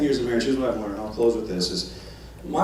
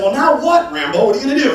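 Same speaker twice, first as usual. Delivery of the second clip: loud, high mocking taunt; sarcastic challenge; 8 semitones higher, 6 dB louder than usual. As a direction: needle her with Rambo. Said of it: "and so I said".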